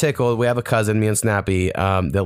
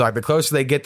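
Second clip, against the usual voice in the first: ordinary talk, nothing marked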